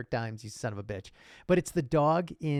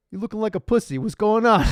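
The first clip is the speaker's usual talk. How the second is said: loud, raised-pitch tough-guy needling; mock street confrontation; 7 semitones higher, 8 dB louder than usual. A man mock-confronting another man about looking sad, loud and teasing. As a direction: laugh creeping into a mock challenge. Acting out men talking to men.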